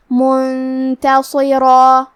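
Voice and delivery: slow chant, the nun of منتصرہ held as a hum before t; hidden nasal n. Shown as a demonstration. Teacher shows ikhfa, slow chanted منتصرہ with held hum. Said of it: "غنہ کے ساتھ ادا کریں گے".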